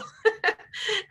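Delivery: high bubbling laugh; delighted laugh. Real, not acted. Speaker laughing at her own line about certificates, high and delighted.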